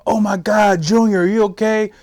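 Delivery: high, loud, panicked outburst; frantic mom. Retold or imitated. Mom on the phone to her shot son, frantic. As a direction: cry out in a worried rush. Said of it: "talking a thousand miles an hour".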